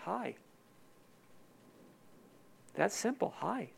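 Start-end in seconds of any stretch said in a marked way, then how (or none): 0.1-0.3 soft hi. Pretend. A simple greeting to God. 3.4-3.7 soft hi. Pretend. A simple greeting to God.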